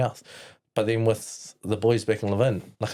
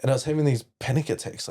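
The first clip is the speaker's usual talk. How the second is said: ordinary talk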